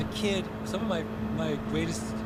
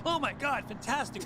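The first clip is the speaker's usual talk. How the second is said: higher, delighted cheer; gushing Twitter fans; 10 semitones higher than usual. Retold or imitated. Nigerians online welcoming his DNA result, higher delighted cheer. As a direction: cheer him on like thrilled strangers.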